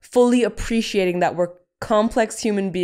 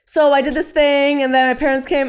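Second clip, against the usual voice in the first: breezy glossing over; 6 semitones higher, 4 dB louder than usual. Retold or imitated. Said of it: "I was like".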